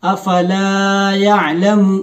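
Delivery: slow, chanted tajweed reading; slow melodic verse recitation; Quran recitation. Shown as a demonstration. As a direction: recite each word stretched and measured.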